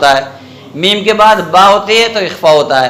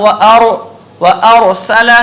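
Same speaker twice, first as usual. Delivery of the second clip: slow, drawn-out Quran recitation, each word separate; 2 semitones higher, much slower than usual. Shown as a demonstration. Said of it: "अलग-अलग पढ़ रहा हूँ". (अलग-अलग, ठहर-ठहर कर तिलावत)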